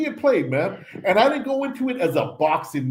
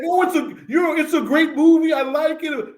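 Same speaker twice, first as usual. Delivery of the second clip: mocking impression; 10 semitones higher than usual. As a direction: parrot him in a silly high voice. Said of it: "You, right off the bat, like".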